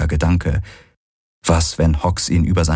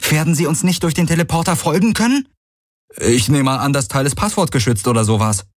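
much higher, quick, called over hoofbeats; calling out; 8 semitones higher than usual. Acted. Kirai and Garland calling while fleeing the insects, high quick calls. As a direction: shout across while galloping.

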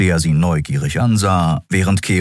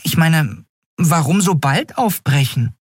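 higher, lightly teasing female voice; Nelen's voice; 11 semitones higher than usual. A narrator's reading. Nelen teasing Endriel about her plan, higher voice. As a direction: ask with a wry lilt. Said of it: "fragte Nelen".

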